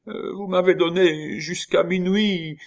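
high, whimpering plea; piteous high-voiced protest; pitiful. A narrator's reading. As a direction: plead pathetically, pitched right up. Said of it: "dit pitoyablement le roi".

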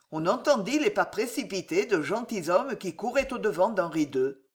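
plain reading voice

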